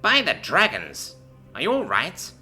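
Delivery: alarmed. A narrator's reading. Lieutenant alarmed at fallen private.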